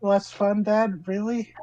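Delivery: a hint of a teasing daughter on the phone; daughter's voice. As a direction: tease your dad for being let down. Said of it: "the voice of your daughter".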